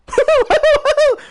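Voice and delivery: very high, loud whooping laugh; loud, zany falsetto hoots; cartoon duck whooping. Duck's cartoon hoot after impression.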